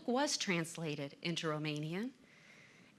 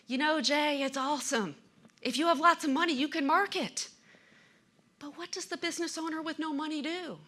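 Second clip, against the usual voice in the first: students' questions in a higher, louder voice, a mild role play; voicing eager students; 7 semitones higher, 4 dB louder than usual.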